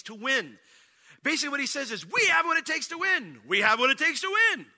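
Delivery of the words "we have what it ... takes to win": high, loud, said twice for emphasis; emphatic rallying cry. Acted. Caleb convincing the people they can win, emphatic shout. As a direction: drive it home to the crowd, then repeat. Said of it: "saying it twice for emphasis".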